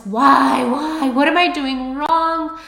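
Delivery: high, loud, tearful begging; desperate pleading. Retold or imitated. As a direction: plead like you're at the end of your rope. Retelling crying pity party.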